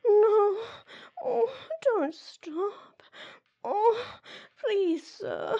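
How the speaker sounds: high, loud pleading cries building; breathless rising moans; climaxing moans. Acted. Escalating moans during spanking.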